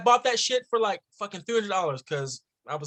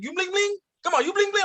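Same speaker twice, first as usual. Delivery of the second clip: put-on high, pestering Middle Eastern-accented seller; comic high-pitched Middle Eastern accent; 11 semitones higher than usual. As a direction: imitate a nagging vendor with a Middle Eastern accent, voice way up. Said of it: "it was a Middle Eastern origin accent".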